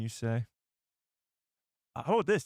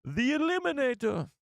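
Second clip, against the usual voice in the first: very high, nasal cartoon villain voice on "The Eliminator"; Doofenshmirtz; 12 semitones higher than usual. Streamer jokes the weapon is a mad scientist's gadget, villain voice. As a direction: say "The Eliminator" like a cartoon evil scientist naming his invention. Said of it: "Doofenshmirtz invention".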